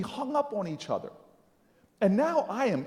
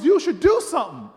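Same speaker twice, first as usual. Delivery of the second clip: loud, raised insistence; emphatic repeat; 4 semitones higher, 9 dB louder than usual. Retold or imitated. Miss Jones repeating her advice, loudly insistent. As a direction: drive the advice home forcefully.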